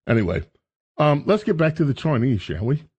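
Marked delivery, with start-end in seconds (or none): none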